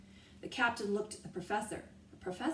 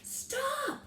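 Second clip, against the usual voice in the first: high sharp cry; cry of stop. A narrator's reading. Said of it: "cried Annie".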